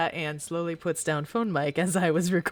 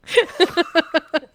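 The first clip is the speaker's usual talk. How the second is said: giggling laugh; 11 semitones higher, 7 dB louder than usual. Real, not acted. Laughs after song.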